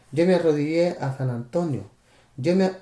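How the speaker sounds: usual speaking voice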